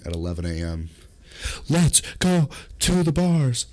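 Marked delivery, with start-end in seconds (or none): 1.7-3.7 high, loud party caller; rowdy friend rallying everyone; hollered invitation. Acted. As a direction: whoop it out slowly, word by word. Acts caller yelling on phone.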